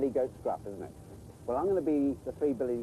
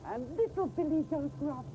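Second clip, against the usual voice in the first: much higher, small goat voice; little goat voice. Acted. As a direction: squeak it like the tiniest goat.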